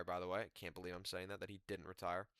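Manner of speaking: soft, under-the-breath aside; quiet aside. Real, not acted. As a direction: drop your voice for the aside.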